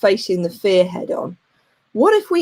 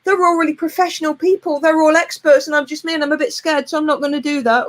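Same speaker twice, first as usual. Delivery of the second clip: mock panicky whine; 5 semitones higher than usual. Imitating her scared self refusing to speak on stage, mock panic. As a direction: whine like a scared beginner backing out. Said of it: "Oh, no, no".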